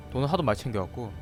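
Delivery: usual speaking voice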